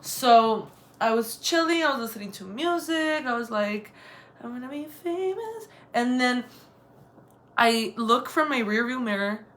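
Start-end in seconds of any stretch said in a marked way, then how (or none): 4.4-5.7 high, soft mock-dreamy lilt; light, high and airy, as if daydreaming aloud; dreamy self-quote. Woman daydreaming while waiting at the tire shop, dreamy. Acts out singing along to music.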